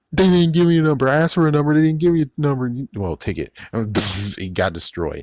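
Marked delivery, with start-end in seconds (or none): 0.1-2.7 much higher, louder whine; whiny complaint. Retold or imitated. The jerk griping that he got no number, loud high whine. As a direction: complain like a wronged customer. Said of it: "the dude was like".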